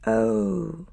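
high eerie half-whisper; grey, terrible voice. A narrator's reading. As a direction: let it out thin and whispery, with menace. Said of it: "That grey and terrible voice".

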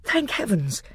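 ordinary delivery

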